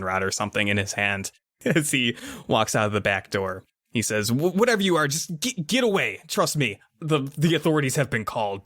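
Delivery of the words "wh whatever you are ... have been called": high, stammering order; nervous warning. Acted. Neighbor with a stun rod to the monster, nervous warning. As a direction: stumble into a warning, pitched up.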